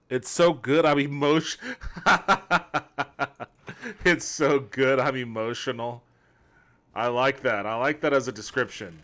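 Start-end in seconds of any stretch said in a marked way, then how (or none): 0.1-6.0 laughing. Real, not acted. Reads chat, laughing.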